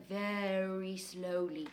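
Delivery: said as slowly as he wrote. Shown as a demonstration. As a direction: drag it out, x0.5 slow. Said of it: "writing very".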